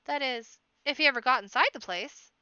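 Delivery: plain reading voice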